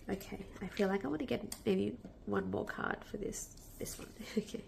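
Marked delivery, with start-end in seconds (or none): none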